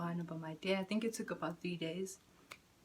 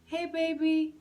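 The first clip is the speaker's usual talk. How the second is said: high, louder sing-song greeting; cooing to a bird; 9 semitones higher, 6 dB louder, slower than usual. Real, not acted. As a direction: call sweetly to the little bird. Greets a little bird outside.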